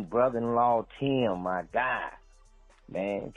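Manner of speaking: ordinary delivery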